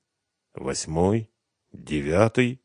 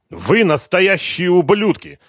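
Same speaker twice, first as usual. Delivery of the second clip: loud, high, slow furious outburst; angry; 11 semitones higher, 6 dB louder, slower than usual. A narrator's reading. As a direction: spit it out in fury. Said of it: "гневно заявил он".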